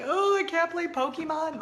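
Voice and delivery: lightly voiced complaining child; hint of a sulky kid losing a game; whiny kid. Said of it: "she's like".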